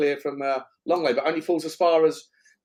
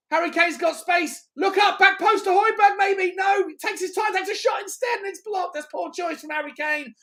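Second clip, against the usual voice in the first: excited commentary; 13 semitones higher than usual. Real, not acted. Live attack then frustration.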